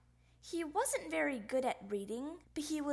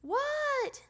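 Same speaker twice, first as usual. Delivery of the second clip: high, loud shouted question; yelled reply; 13 semitones higher, 5 dB louder than usual. A narrator's reading. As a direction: holler it back, pitched way up. Froggy yells back to librarian.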